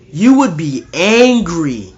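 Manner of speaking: indignant preaching. Real, not acted. Preacher describing a parent's rage.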